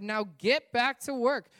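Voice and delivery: loud, slow barked command; shouting order. Acted. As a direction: drive them back to work with a shout. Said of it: "But Pharaoh shouted".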